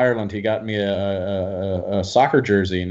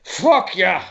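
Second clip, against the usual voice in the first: put-on cheerful drunk; 6 semitones higher, 5 dB louder than usual. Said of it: "He just cracks up".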